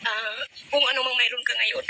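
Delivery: tearful. Real, not acted. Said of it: "naging emotional lang ako".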